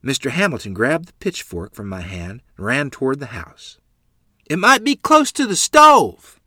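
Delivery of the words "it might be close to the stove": high, loud yell. Acted. Mother warning the neighbor, loud high yell. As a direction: yell the warning across the yard. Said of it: "Mother yelled".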